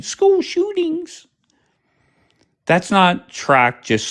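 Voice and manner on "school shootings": high, slow mocking voice; mocking chat quote. Host mocking chat commenters in a high voice. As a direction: parrot the chat in a silly high voice. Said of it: "you fucking dumbasses that are in the chat saying".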